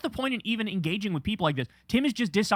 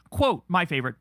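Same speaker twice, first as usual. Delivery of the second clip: normal talking voice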